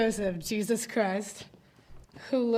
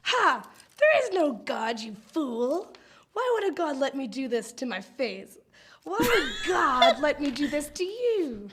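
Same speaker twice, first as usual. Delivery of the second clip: evil witch voice; 4 semitones higher than usual. Acted. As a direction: sneer like a villain witch. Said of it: "Now I have to do an evil witch voice".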